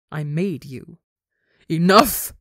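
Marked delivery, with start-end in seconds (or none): 1.7-2.3 loud, forceful one-word shout; bellow. A narrator's reading. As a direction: bellow it to end the argument. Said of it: "Ranbu bellows".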